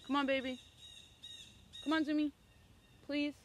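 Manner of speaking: high, loud coaxing call to a dog. Real, not acted. Owner coaxing her dog back through the woods, high and loud. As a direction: sing out to the dog to come.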